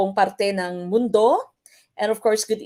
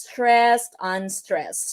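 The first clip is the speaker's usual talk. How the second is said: weight on "stressed", let "unstressed" fall away; trochaic stress pattern; much slower than usual. Shown as a demonstration. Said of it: "the correct choice is trochaic".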